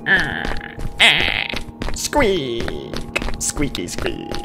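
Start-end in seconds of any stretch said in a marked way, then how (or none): none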